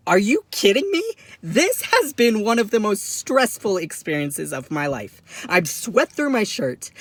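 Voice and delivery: high, loud, incredulous protest; mock outrage, leaning on each complaint; exasperated outburst. Traveller told he can go after a stressful search, exasperated outburst.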